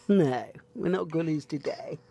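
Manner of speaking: ordinary talk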